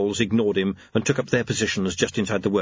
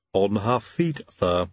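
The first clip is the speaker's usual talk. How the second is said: sighing Igor lisp; 2 semitones lower, slower than usual. Acted. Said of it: "sighed Igor".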